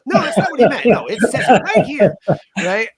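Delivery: loud, high-pitched insistent rebuttal; heated debate. Retold or imitated. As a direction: argue the point hard, voice jumping up. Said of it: "heated debates".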